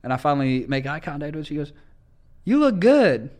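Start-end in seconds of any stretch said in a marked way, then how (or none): 2.4-3.3 high, loud flirty call from a car.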